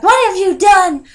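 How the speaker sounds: high, loud accusing cry; furious shriek. Retold or imitated. Mother shrieks at the dreamer, high and loud. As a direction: shriek it at the girl who killed her baby. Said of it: "And she was like".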